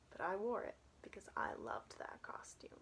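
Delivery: soft unvoiced whisper; confiding whisper. Real, not acted. Telling listeners of her loved costume, whispered. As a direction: share it like a secret.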